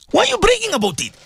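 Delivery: fast, high repeated challenge. Real, not acted. Preacher pressing the bragging donors again, fast and high.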